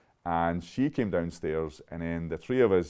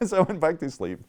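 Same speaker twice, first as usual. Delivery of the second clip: higher, louder, breaking into a laugh; 11 semitones higher, 6 dB louder than usual. Real, not acted. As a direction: laugh through the words. Laughs at own story while retelling.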